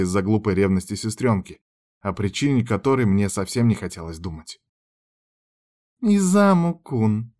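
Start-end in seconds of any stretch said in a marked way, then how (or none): 6.0-7.3 bright drawn-out call, slowly; cheerful. A narrator's reading. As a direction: call his name happily and slowly. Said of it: "начала рыженькая, весело улыбаясь".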